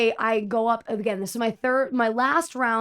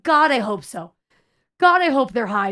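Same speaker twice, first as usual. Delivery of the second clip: louder, emphatic plea to the heavens; exasperated; 2 semitones higher, 7 dB louder than usual. Retold or imitated. Server to manager about the kids, exasperated. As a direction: beg it to be true.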